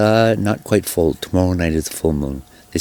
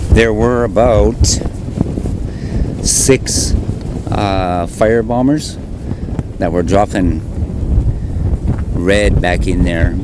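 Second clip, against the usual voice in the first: shouting over wind; 3 semitones higher, 6 dB louder, slower than usual. Real, not acted. Filming fire bombers in wind.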